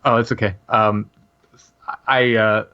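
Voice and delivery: ordinary talk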